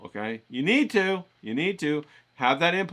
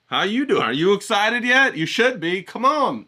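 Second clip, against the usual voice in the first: high, loud pep-talk questions; hype-up check-in; 6 semitones higher, 5 dB louder than usual. Real, not acted. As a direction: fire up the audience like a coach. Hyping up the viewer again.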